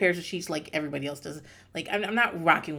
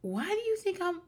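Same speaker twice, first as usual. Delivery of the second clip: very high, softer squeaky disbelief; incredulous squeal; 13 semitones higher, 5 dB quieter than usual. Retold or imitated. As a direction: squeak the question in mock bafflement. Acting out her baffled reaction.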